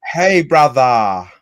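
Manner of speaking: drawn-out, loud, high Australian call; mock Australian accent. Streamer joins friend's Australian bit, mock Australian accent. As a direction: stretch it into a hammed-up Australian greeting. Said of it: "the Australians?".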